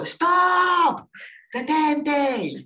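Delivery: high, loud, drawn-out yell; full-voiced, urgent imitated shout; retold shout. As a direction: scream the warning as the friend did. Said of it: "ya me gritó".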